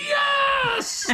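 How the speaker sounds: put-on very high-pitched gleeful kid scream breaking into laughter; inner kid screaming. Mechanic voicing his inner kid at a ride invite, screaming. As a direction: squeal it like an overexcited child. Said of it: "the kid inside of me is screaming".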